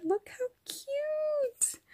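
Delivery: high, slow, soft squeal over something cute; cutesy squeal. Real, not acted. Showing a friend's gift to viewers, high soft cooing squeal.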